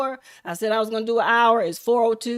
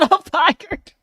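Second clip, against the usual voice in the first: high, laughing plea, lightly acted; fired man pleading; 10 semitones higher, 4 dB louder than usual. Fired worker begging boss Chris to undo it, high laughing plea. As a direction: beg Chris to take it back, giggling. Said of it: "He said".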